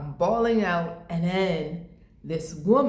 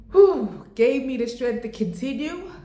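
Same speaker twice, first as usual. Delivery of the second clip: fighting tears; 6 semitones higher, 5 dB louder than usual. Real, not acted. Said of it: "I just said I wasn't gonna cry about it".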